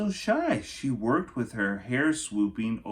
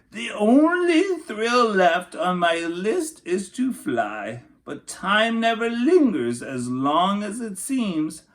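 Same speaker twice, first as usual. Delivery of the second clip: put-on higher, louder wistful old lady; old aunt's voice; 9 semitones higher, 6 dB louder than usual. A narrator's reading. Great aunt Rose tells Rosie her last dream, wistful and high.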